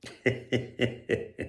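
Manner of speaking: snigger quietly to yourself; soft, voiceless hee-hee; amused snicker. Real, not acted. Amused while searching Spotify.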